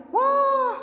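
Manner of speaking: shocked wah. Acted. Disappointed by exam result.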